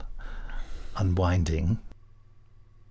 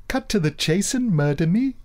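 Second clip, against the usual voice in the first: mock plea; 8 semitones higher than usual.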